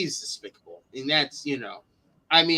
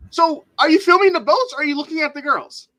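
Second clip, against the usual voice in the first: high, louder mock questioning; mock challenge; 12 semitones higher, 6 dB louder than usual. Challenging the man with the camera, high mock questioning. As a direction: put the question to him, pitched way up.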